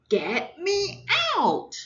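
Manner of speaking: put-on high, loud, slow child's yell; shouted. Child yelling to be let out while shopping. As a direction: yell it stuck in the cart. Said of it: "I shouted".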